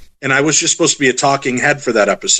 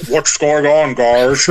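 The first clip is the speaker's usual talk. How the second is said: muffled masked-villain voice; Bane from Batman; slower than usual. Retold or imitated. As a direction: speak as if through a breathing apparatus. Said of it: "like Bane from Batman".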